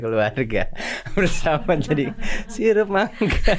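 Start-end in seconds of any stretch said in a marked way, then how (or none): 1.1-3.6 laughing. Real, not acted. Punchline through laughter.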